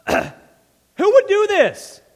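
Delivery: very high, loud, slow outraged cry; hammed-up enraged king; furious shout. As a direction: stretch it out in mock fury. King's outrage acted again.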